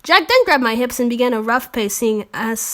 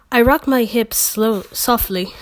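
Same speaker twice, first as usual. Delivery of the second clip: usual speaking voice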